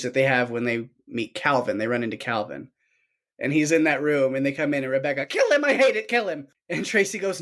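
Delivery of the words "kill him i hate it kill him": high, urgent mock outburst; mock frantic demand. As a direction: imitate someone frantically ordering an attack. Retells Rebecca demanding a killing.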